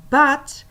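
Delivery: "But" loud and high, then a pause; punched "But" left hanging; pause for attention. Shown as a demonstration. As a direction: land hard on "But" and stop. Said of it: "But," pause, and then I continued".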